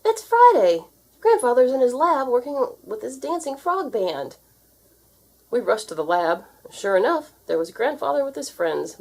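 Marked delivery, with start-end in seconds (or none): 0.0-4.4 high, loud, excited news; excited shout. A narrator's reading. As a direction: burst out with the news. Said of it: "yelled Wilbur".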